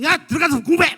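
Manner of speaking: loud, high barked order; mock parental shout. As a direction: yell at the kids like a nagging mom. 엄마가 공부하라고 호통. (버럭 호통치는 엄마 흉내)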